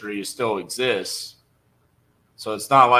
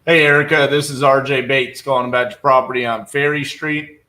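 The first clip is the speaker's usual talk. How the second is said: loud, higher voice on a voicemail; loud phone greeting; 4 semitones higher, 8 dB louder than usual. Real, not acted. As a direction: call the greeting out loudly. Leaving a voicemail for seller.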